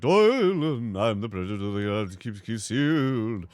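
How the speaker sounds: grand knightly announcement; put-on knight voice. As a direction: proclaim it as a knight, drawing out key words. Voices game character's dialogue.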